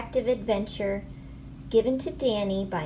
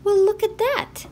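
high, loud playful exclamation; excited reveal to the kids; mock surprise; 10 semitones higher, 7 dB louder than usual. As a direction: burst out in big storybook surprise. Reaching the book's last page.

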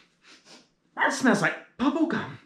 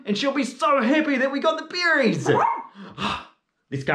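ordinary talk

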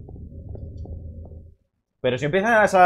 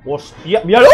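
usual speaking voice

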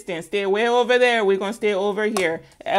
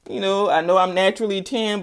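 ordinary talk, nothing marked

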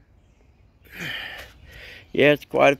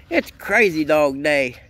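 ordinary delivery

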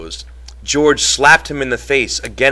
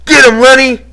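very high, loud, carrying yell; hands-cupped yell; 11 dB louder than usual. A narrator's reading. George calls across to Lenny to fight, full yell. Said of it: "cupped his hands around his mouth and yelled".

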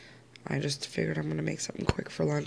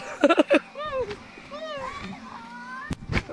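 long squealing laugh rising at the end; high squealing laugh; 5 dB louder than usual. Real, not acted. Laughing at something going wrong.

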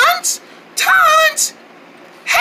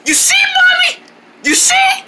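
urgent pointing out; 10 semitones higher than usual. Acted. Showing mother the spider.

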